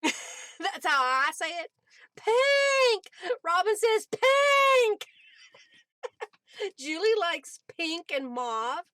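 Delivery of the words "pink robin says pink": high, loud happy shout of the word; a burst of glee at the color; excited cry. Real, not acted. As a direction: call it out with delight. Reading a viewer's favorite color.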